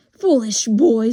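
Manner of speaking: very loud scolding; scornful witch. Acted. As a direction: rebuke the boy at full volume. Witch scolds boy she chases.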